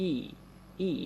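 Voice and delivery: ই held long; long ই sound. Shown as a demonstration. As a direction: draw the ই out slowly. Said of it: "একটু সময় বেশি নিতে হবে পড়ার সময়". (টেনে লম্বা ই উচ্চারণ)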